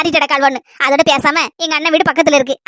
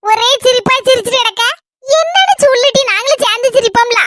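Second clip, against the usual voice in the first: put-on nosy old woman; 4 semitones higher than usual. Old woman nags girl to tell.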